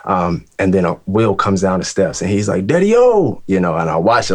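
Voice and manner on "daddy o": high, loud joyful call; excited greeting. Retold or imitated. Will greets his father on the stairs, loud and delighted. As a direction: call out to Dad, thrilled.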